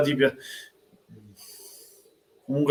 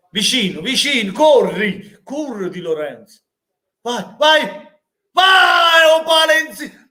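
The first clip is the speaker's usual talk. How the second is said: excited yelling; 8 semitones higher, slower than usual. Real, not acted. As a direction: cheer the striker on at full voice. Fan yelling at near miss. (urla da tifoso)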